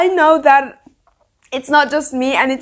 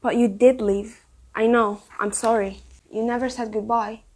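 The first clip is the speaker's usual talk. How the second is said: much softer, hushed reading of the lines; 3 semitones lower, 8 dB quieter than usual. Acted. Quoting the book's prologue to viewers, soft and hushed. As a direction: read the dialogue gently under the breath. Reading a tender book passage.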